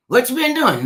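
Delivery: high, loud cheerful greeting; chirpy friendly greeter. Retold or imitated. Imitating a friend greeting cheerfully, high and loud. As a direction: sing out a bright hello. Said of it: "one of those women that says".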